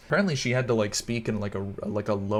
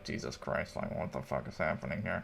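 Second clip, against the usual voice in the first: low, hushed disgusted aside; muttered disbelief, voiced for a character; disgusted Saruman; 6 semitones lower, 9 dB quieter than usual. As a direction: say it under your breath, mildly appalled. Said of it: "like, a little bit disgusted too".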